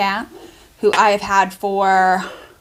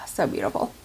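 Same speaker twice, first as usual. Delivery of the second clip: sighed out almost voicelessly, much softer; breathy sigh; 8 semitones lower, 10 dB quieter, faster than usual. Real, not acted. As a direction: let the words ride out on a sigh of admiration. Admiring the tumbler, sighing.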